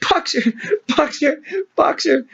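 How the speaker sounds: high desperate call; cried out. Acted. Clover runs after the van calling Boxer, desperate high cry. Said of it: "she cried".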